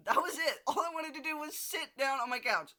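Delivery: loud, high, laughing through the words; half laughing at own fury; laughing while ranting. Real, not acted. Laughing through her frustrated rant.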